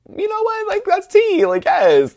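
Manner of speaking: very high, loud delighted squeal; squealing agreement. Retold or imitated. Narrator squeals back to Book in high, loud delight. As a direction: shriek it like the best news ever. Narrator's gleeful reply to friend.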